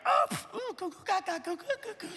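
put-on high baby cooing, softening; squeaky little gurgles; baby babble. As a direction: imitate a suddenly contented baby. Baby lands and babbles happily.

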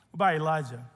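normal talking voice

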